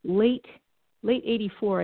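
normal talking voice

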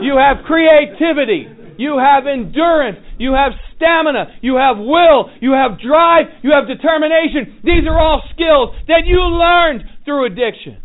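rising preacher shout; building to a shout. Real, not acted. Building sermon list to shout.

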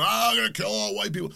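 high, mocking attacker yell; high mock rant. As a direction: imitate a ranting madman, high-pitched. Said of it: "He's yelling".

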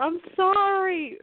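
high, slow sorry squeezed out while laughing; apology through laughter. Real, not acted. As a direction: try to apologise but can't stop laughing. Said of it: "but I'm laughing so hard".

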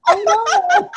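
high, loud delighted laugh. Real, not acted. Laughing with the host about his line. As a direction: burst out laughing at the memory.

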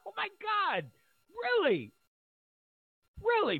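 can you believe this, really?; incredulous. Real, not acted. Mocking claim she's healthy.

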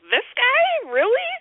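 high, disbelieving "This guy? Really?"; incredulous. Retold or imitated. Viewer reacting to the movie's reveal, incredulous and high.

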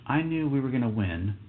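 ordinary talk

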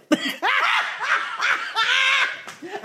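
high, shrieking burst of laughter; big laugh. Real, not acted. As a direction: crack up, high and long. Laughing at the repeated line.

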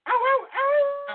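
high, slow drawn-out ow cries; show ender howl. Acted. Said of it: "Ooh, show ender".

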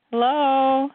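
high, loud hello down the line. Real, not acted. Caller not heard on line.

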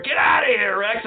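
high, loud yell to get out; angry shout. Acted. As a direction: scream him out of the room. Yelling at intruder.

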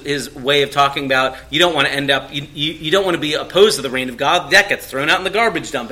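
ordinary delivery

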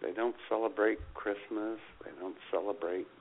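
usual speaking voice

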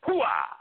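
loud barked Hooah; Hooah yell. Acted. Impersonator yelling Hooah at the mention of Scent of a Woman. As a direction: bark out the trademark Hooah.

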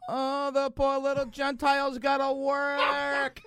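very high, drawn-out teasing coo; slow, high-pitched mocking sing-song; mock pity. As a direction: coo with fake sympathy to rub it in. Mimics guests teasing the doorman.

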